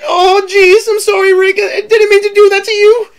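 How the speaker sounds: Morty impression. Shown as a demonstration. Streamer doing Morty for his guest, tearful and flustered. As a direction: stammer the apology in a fretful wail. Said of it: "I practice my voices".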